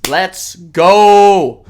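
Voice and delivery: loud, triumphant whoop; excited cheer. Real, not acted. Ranter cheering the news of the deportation, loud excited whoop. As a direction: shout it like your team just scored.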